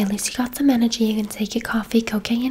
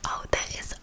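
soft, breath-only whisper; ASMR whisper; 7 dB quieter than usual. Acted. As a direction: whisper it close to the client. Whispered ASMR salon roleplay.

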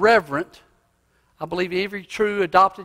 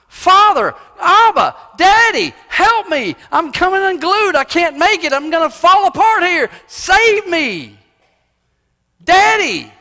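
much higher, louder heartfelt cry; desperate cry; 11 semitones higher, 8 dB louder than usual. Retold or imitated. Believer crying out to God, high desperate wail. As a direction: cry out to Daddy like you're falling apart. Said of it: "desperate, affectionate cry".

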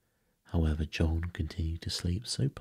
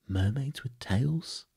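slow, high, wondering question; amazed question; 7 semitones higher, slower than usual. A narrator's reading. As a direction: ask in wonder, stretched and rising. Wendy amazed to hear of mermaids.

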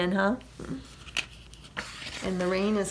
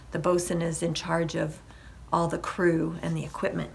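plain reading voice

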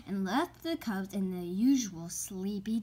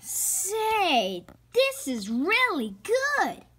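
higher and louder, slow and eager; excited; 9 semitones higher, 5 dB louder, much slower than usual. Acted. Brother delighting in the mystery he is reading. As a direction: gush about the book. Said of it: "said Brother".

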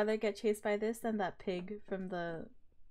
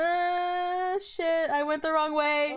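panicked; 5 semitones higher, 9 dB louder, much slower than usual. Real, not acted. Panicked after wrong turn.

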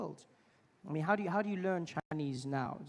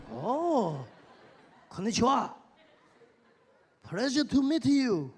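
Japanese man; 6 semitones higher, 4 dB louder, slower than usual. Retold or imitated. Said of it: "He's like".

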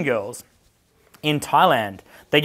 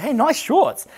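high-pitched mock compliment; put-on high, impressed voice; admiring passers-by; 11 semitones higher, slower than usual. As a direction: imitate strangers calling out in admiration. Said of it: "people are like".

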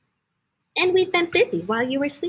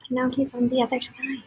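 soft unvoiced whisper; hushed aside; 4 dB quieter than usual. A narrator's reading. Reading to a child, soft whispered reveal. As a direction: whisper it like a secret.

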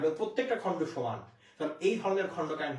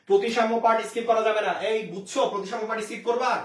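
louder, firm teacher scolding; 5 semitones higher, 6 dB louder than usual. Real, not acted. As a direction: drive it home that skipping is forbidden. Teacher scolds students skipping topic. (ধমক দিয়ে জোর গলায় বলা)

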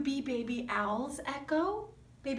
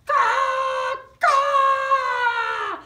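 loud, harsh caw; jayhawk caw; 9 semitones higher, 10 dB louder than usual. Acted. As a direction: squawk it out like a jayhawk. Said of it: "No, no, no".

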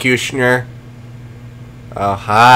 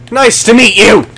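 bright high hello to the dogs, brisk and short; cheery greeting; 8 semitones higher, 5 dB louder than usual. Acted. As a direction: greet them quickly, pitched up.

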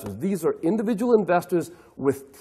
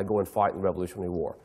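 much lower, softer and fast, thrown away; low, limp battle cry; 11 semitones lower, 7 dB quieter, faster than usual. Retold or imitated. Dawes' ignored battle cry, low, soft and rushed. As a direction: let the rally call fall flat and mumbled.

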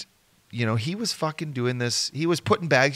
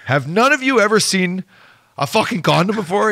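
high, loud incredulous question; mock exasperated scolding; 6 semitones higher, 6 dB louder than usual. Man berating players over condoms, loud mock exasperation. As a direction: scold them in disbelief, pitched up.

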